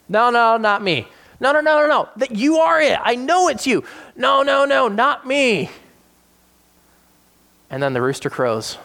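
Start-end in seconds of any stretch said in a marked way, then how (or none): 0.1-1.1 higher, louder, frantic protest; panicked denial. Retold or imitated. Peter to the bystanders, panicked denial. 1.4-3.8 high, loud, jeering no, no, no; insistent accuser. Retold or imitated. Bystander to Peter, loud insistent accusation. 4.1-5.7 higher, louder, frantic protest; panicked denial. Retold or imitated. Peter to the bystanders, panicked denial. Peter denies third time.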